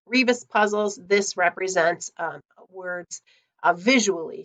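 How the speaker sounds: ordinary talk, nothing marked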